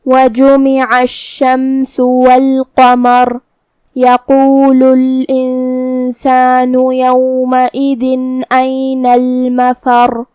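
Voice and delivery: slow tajweed recitation with long-held vowels; Quran recitation. Shown as a demonstration. Teacher opens the lesson's verses, slow Quran recitation. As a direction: recite slowly and evenly, drawing out the syllables.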